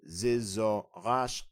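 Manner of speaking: "Zizaqasha" very slow, syllable by syllable, rising at the end. Shown as a demonstration. Breaking down the word for learners, slow and rising. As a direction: stretch out each syllable of "Zizaqasha". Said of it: "Now the phrase for "I am learning".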